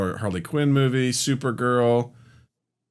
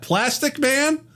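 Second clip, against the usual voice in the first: high, drawn-out disbelief; incredulous question; slower than usual. Real, not acted. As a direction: stretch it upward like you can't believe it. Baffled at a listed title.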